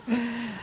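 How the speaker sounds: deep hacking cough; chesty cough. Real, not acted. Coughing fit during speech.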